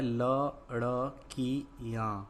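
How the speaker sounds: लड़कियां split into slow separate syllables; लड़कियां drawn out, every syllable held apart. Shown as a demonstration. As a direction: sound out लड़कियां piece by piece. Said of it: "तो क्या शब्द बना".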